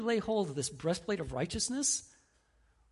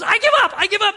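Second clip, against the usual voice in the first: much higher, much louder yelled surrender; desperate shout; 15 dB louder, faster than usual. Retold or imitated. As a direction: scream it out to make the shooting stop. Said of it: "And the robber goes".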